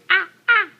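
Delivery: put-on high, loud playful monkey ah-ah for a toddler; monkey noise. Shown as a demonstration. Mother showing her toddler the monkey sound, loud and high. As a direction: show the baby how a monkey goes. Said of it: "Monkey says".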